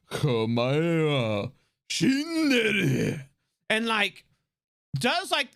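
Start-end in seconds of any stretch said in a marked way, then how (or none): 0.1-3.3 slow, lower dramatic anime finisher; mock-epic battle cry; anime attack call. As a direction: drag it out like an anime finishing move. Heart's dramatic anime attack line.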